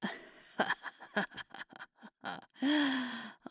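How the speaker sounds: high, loud cackling laugh; bursting laugh. Acted. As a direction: crack up at a partner's awful joke. Laughs at partner's joke.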